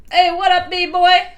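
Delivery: put-on loud, high hey what up, bee boy; brash mosquito hollering a hello; loud mosquito greeting. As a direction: call out like a cocky bug buddy. Mosquito greets Barry loudly.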